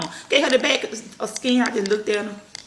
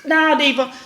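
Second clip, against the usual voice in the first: firm; 6 semitones higher, 5 dB louder, slower than usual. Real, not acted. Mother telling her toddler no, loud and high. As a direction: stop her firmly.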